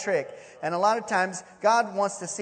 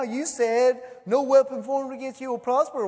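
higher, louder doubter's taunt; mock challenge; 5 semitones higher, 5 dB louder than usual. Doubter confronts the preacher about faith, mock challenge. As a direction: throw the promise back accusingly.

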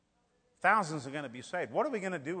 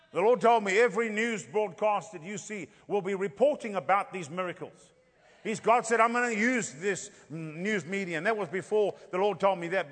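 raised, loud revival preaching, slowing on key phrases; fiery preaching; 4 semitones higher, 6 dB louder than usual. Acted. Preacher telling the church of coming miracles, at full force. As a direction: drive the vision home to the congregation.

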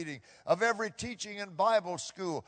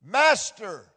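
crying out; 3 semitones higher, 9 dB louder than usual. Acted. Lepers crying out to Jesus.

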